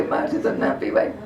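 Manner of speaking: put-on high, hushed old man's refusal; a thin old man backing out; old father refusing. Old father refuses the guru's potion, high and hushed.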